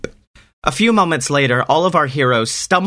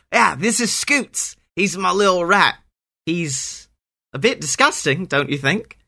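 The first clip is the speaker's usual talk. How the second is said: put-on high boy voice; 5 semitones higher than usual. Greg introducing his rat.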